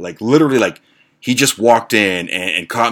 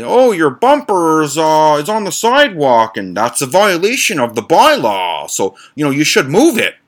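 mock neighbor; 6 semitones higher, 4 dB louder than usual. Neighbor voiced in story.

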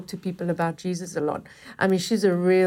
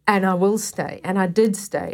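ordinary talk

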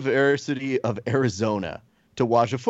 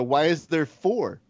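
slow scolding; 4 semitones higher than usual. Retold or imitated. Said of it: "and I go".

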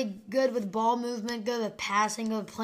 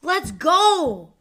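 excited; 6 semitones higher, 7 dB louder than usual. Real, not acted. After shooting mini hoop.

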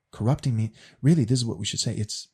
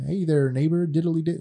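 higher, louder, chirpy neighborly sing-song; Ned Flanders impression; 5 semitones higher, 5 dB louder than usual. Mocking Ned Flanders to show his sugary nice speech, lightly voiced cheery neighbor. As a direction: put on an overly cheerful goody-goody neighbor voice. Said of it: "because he's just like".

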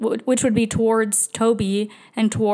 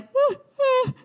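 high, thin whine of a nervous dog; mimicking the dog's worried whining; imitated dog whimper. Dog pacing, whining in hallway.

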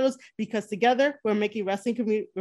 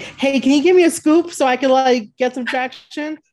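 pushy ask; 3 semitones higher, 9 dB louder than usual. Retold or imitated. Imitated begging a contact.

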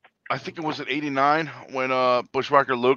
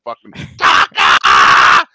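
frenzied fan scream; 12 semitones lower, 10 dB louder, much slower than usual. Retold or imitated. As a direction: scream it standing on a chair. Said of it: "on top of his chair screaming".

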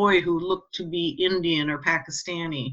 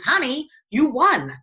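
higher, slow and deliberate; emphatic news; 9 semitones higher, 4 dB louder than usual. Retold or imitated. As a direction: spell it out so she believes it. Husband repeating news patiently.

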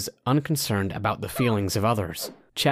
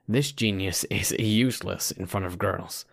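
usual speaking voice

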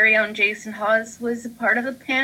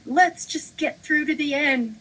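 encouraging friend; 8 semitones higher, 3 dB quieter than usual. Retold or imitated. Friend urging on in heat.

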